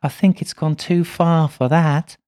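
put-on slow, high little girl spirit; little girl's voice. A narrator's reading. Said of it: "In Madimi's voice".

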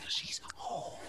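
hushed whisper; secretive whisper. Retold or imitated. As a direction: say it under your breath. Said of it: "You said it like a four-year-old kid".

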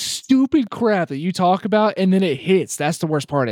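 real worked-up exasperation; high-pitched indignant complaint; hot and bothered. Real, not acted. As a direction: vent it, stretching the gripe. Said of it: "got me all hot and bothered".

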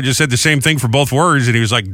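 ordinary talk, nothing marked

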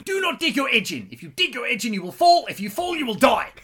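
high-pitched imitated scream; screaming instructor. Snowboarding instructor screaming at a fallen learner, imitated. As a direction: mimic an instructor yelling from far up the slope. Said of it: "literally screaming".

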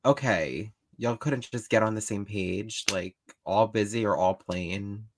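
low, exasperated complaint. Retold or imitated. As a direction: sigh it out, fed up. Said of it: "I'm like".

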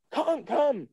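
high, drawn-out beckoning; high-pitched retold beckoning; calling someone over. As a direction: call them over eagerly in a high voice. Retold star calling him over.

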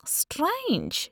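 high-pitched, knowing remark; contained smugness. A narrator's reading. Woman catching out the supposed Italian, barely contained smugness. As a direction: let smug suspicion leak out. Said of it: "trying to contain her own smugness".

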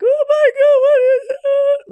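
imitated childlike wail, very high and loud; hammed-up sobbing, like a small child; sobbing four-year-old. Retelling how she found the worm, imitated hysterical sobbing. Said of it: "sobbing like a four-year-old".

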